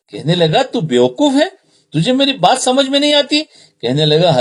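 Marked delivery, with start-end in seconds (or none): 0.6-3.4 high-pitched, exasperated telling-off; mock-irritated rebuke, pitch jumping high; acted angry scolding. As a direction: scold a pest who won't listen, as a storyteller. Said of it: "وہ غصے میں کہنے لگے".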